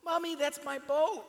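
high, rising cry of discovery; excited recognition. A narrator's reading. Boy telling his mother he has found his boat, high and eager. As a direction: call it out as he spots the boat. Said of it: "he says".

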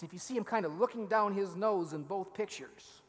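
normal talking voice

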